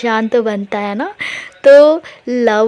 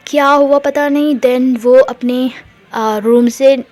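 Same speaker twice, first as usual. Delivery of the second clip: plain reading voice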